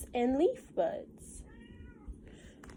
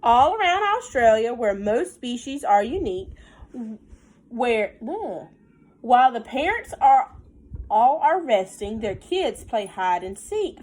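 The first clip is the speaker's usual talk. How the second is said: lilting storybook read, slowed on the rhymes; reading to children; 2 semitones higher, 6 dB louder than usual. Acted. Teacher reading a counting rhyme to her class, sing-song storybook voice. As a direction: read the rhyme aloud to the little ones. Reads picture book aloud.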